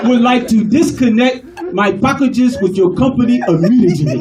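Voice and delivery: put-on formal, measured African accent; dignified African accent. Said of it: "they so dignified and eloquent".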